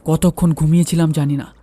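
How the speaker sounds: usual speaking voice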